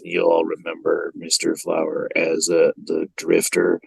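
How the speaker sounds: low-voiced drifter impression; George Buck Flower. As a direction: play a low, rambling old movie drunk. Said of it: "doing a wonderful impression of this man".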